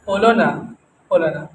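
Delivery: low, soft, fading retold lament; subdued confession. Disciple admitting failure to his Lord, acted as a subdued confession. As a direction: let the words sink away quietly.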